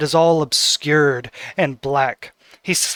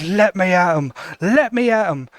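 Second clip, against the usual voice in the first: put-on eager kid cry; 4 semitones higher than usual. Squidly waving wooden sword.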